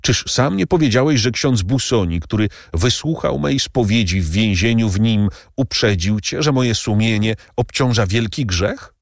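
slowing on the last words; anxious servant Bertuccio. A narrator's reading. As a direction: insist on your honesty to the count. Bertuccio voiced throughout.

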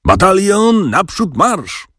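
high-pitched major's battle order; narrator's restrained parade-ground yell; bellowed command. A narrator's reading. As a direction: call it out long and high, without full volume. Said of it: "wrzasnął ogromnym głosem nasz major".

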